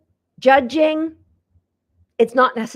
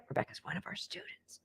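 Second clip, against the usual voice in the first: whispered aside; 6 semitones higher, 20 dB quieter, faster than usual. Real, not acted.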